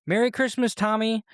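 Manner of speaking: lightly imitated yelled greeting; mock friendly yell. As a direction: call it out without really shouting. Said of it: "The Germans yell stuff like".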